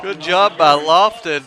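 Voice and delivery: words stretched out through a yawn. Real, not acted. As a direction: say it mid-yawn, slow and drawn. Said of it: "Got the yawns all of a sudden".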